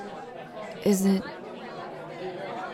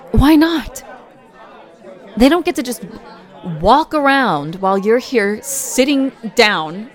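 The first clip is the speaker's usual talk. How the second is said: indignant; 4 dB louder than usual. Acted. Protective partner storming off angrily.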